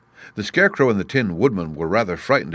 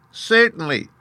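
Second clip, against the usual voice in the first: very high, squeaky little voice; high-pitched mouse royalty; Queen of the Mice; 14 semitones higher, 3 dB louder than usual. A narrator's reading. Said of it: "in her squeaky little voice".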